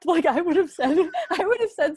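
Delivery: laughing through the words; real laugh while admitting it. Real, not acted. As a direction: let a real laugh carry it. Laughing about own reaction.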